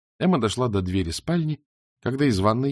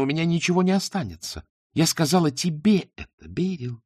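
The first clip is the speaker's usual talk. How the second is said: bitter tirade; 6 semitones higher than usual. Acted.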